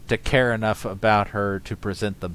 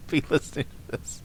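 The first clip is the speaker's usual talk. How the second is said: whispered through laughter, fast and softer; laughing; 4 semitones higher, 6 dB quieter, faster than usual. Real, not acted. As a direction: squeeze the words out while laughing. Still laughing while speaking.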